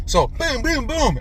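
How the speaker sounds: high, punchy booms for impacts; playful imitated crash noises; mock boom sounds. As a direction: beat out the crashes with your voice. Imitating impacts while retelling.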